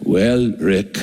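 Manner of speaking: lightly imitated Austrian accent, pitched low; a low hint of an older mentor's Austrian accent. Mentor answering his student about faith, lightly imitated Austrian accent. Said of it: "in that thick Austrian accent".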